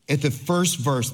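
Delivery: ordinary talk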